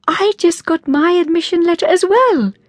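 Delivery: high, loud, thrilled announcement; excited squeal. A narrator's reading. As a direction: burst out with joyful news. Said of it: "hugged each other excitedly".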